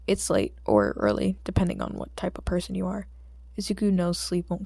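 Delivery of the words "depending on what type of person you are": plain reading voice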